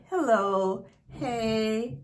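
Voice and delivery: normal talking voice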